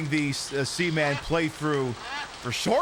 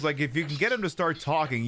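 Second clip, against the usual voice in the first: ordinary delivery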